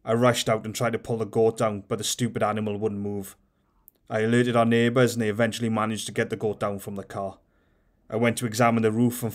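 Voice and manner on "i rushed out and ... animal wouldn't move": plain reading voice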